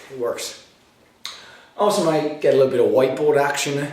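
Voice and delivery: ordinary delivery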